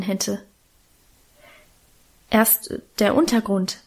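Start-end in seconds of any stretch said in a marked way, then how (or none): none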